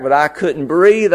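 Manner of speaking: ordinary delivery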